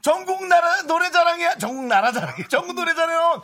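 high, loud eager guess; excited. Real, not acted. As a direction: shout the answer eagerly.

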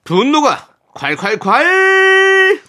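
높고 크게 길게 외치는 분노가 콸콸콸; drawn-out shout. Acted. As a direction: stretch it out in a huge shout. Show segment title shouted. (길게 늘여 외치는 큰 소리)

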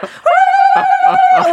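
high sustained chanting cry; one long held note like a rite; ritual chant. Acted. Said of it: "시작".

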